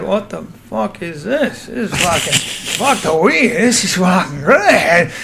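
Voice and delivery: slow, drawn-out mock amazement; put-on voice, slowly marvelling; Jimmy Burke. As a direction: stretch every word, imitating an awed friend. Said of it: "And he's like".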